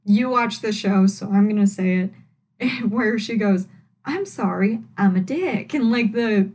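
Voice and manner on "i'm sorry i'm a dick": ordinary talk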